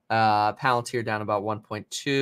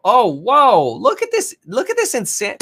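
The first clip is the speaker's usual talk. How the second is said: real surprise, much higher and louder; excited outburst; 9 semitones higher, 9 dB louder than usual. Real, not acted. Streamer spotting a sudden spike, excited and loud. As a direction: blurt it out as the chart jumps.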